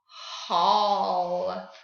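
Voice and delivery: "Hall" drawn out low with long open aw; vowel switch demo. Shown as a demonstration. Teacher reveals the changed word, long low "Hall". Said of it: "Change the I sound to an ah".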